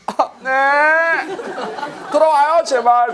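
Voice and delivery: high, loud desperate begging; a hammed-up tearful plea with a laugh; pleading. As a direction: beg the lover to come back. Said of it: "애원하죠, 애원".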